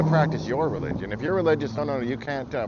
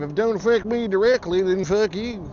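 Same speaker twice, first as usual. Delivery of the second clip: mocking Southern maga drawl; 8 semitones higher, 5 dB louder than usual. Mocking how maga people talk, in a Southern accent. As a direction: sneer it out in a dumb Southern accent. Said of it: "I just assume every maga talks like that".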